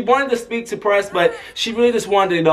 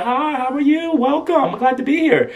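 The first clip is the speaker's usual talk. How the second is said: high-pitched, friendly imitation; gracious greeting; 8 semitones higher than usual. Celebrity welcomes her escort, imitated gracious greeting.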